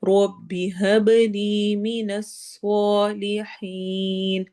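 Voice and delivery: slow, melodic tajweed recitation; repeated slow Quranic recitation; Quran recitation practice. Shown as a demonstration. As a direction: chant the verse slowly and evenly, verse by verse. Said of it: "دونوں دعاؤں کی practice کرتے ہیں".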